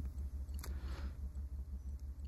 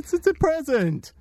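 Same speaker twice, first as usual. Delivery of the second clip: much higher, squeaky put-on voice; high squeaky character. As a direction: squeak it out like a nervous little alien.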